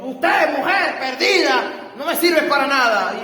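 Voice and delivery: put-on a husband berating his wife in front of the child, shouting; father yelling. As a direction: bark it out like a raging husband. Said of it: "lo escucha a él diciendo".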